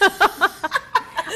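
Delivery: short wry laugh; rueful laugh. Real, not acted. Guest laughing that he is her president too. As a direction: laugh at the awkward truth.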